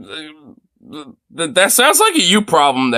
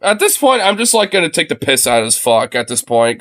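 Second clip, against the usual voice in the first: ordinary delivery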